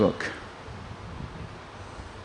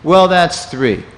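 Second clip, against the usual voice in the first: slow, louder and insistent; pressing; 6 semitones higher, 10 dB louder, slower than usual. Retold or imitated. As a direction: push firmly, word by word. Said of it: "Again, pressing".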